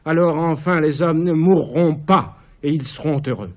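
rising, ringing exaltation; exalted cry. Acted. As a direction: proclaim it like a vision. Caligula exalté, fin de tirade. (exaltation fiévreuse)

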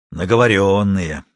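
slow, savoured word; satisfied, unhurried statement; with relish. A narrator's reading. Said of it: "с удовольствием сказал Матвей".

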